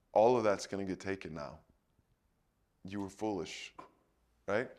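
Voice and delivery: low, quick, grave verdict; grim prophecy. Retold or imitated. Isaiah tells the foolish king his treasure will be taken, low and grave. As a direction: deliver the doom flatly.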